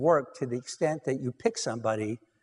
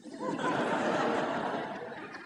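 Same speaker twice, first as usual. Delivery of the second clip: real laugh; 5 dB quieter than usual. Real, not acted. Laughing at the couple's joke.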